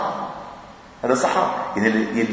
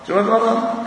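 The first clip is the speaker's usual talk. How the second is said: "شو ما في مرض؟" thrown up high, incredulous and mocking; mock disbelief; 9 semitones higher than usual. Shown as a demonstration. Mocking the debater who denies illness, pitched high in disbelief. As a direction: echo the question way up in mock astonishment.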